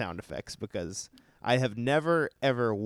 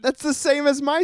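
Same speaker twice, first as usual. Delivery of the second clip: excited; 8 dB louder than usual. Real, not acted. Surprised at matching sound effect.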